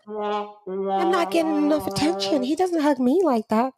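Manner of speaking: jealous American girlfriend, hammed up; American accent. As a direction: whine in a put-on American accent. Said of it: "I gave her American accent".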